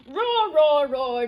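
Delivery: loud triple roar; booming playful roars; dinosaur roars. Acted. Reads aloud Dinosaur's roars.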